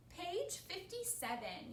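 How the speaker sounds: high, slow reading-out; measured announcement. A narrator's reading. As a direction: announce the page deliberately. Piggy reads out last page.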